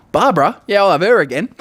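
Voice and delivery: high, loud punchline, cracking up; hammed-up delighted diner; delighted recognition. Punchline as blind diner recognises Barbara.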